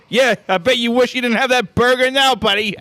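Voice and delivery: mocking shout at the kid; loud heckle. Coach heckling the kid who talked trash, a loud gloat. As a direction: yell the taunt, gloating. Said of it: "Gary, he was like".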